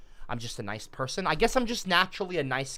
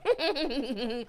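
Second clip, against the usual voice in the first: a shrill little villain giggle, higher; high mocking laugh; 12 semitones higher than usual. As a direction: laugh down at him. Mocking laugh after "we are legion.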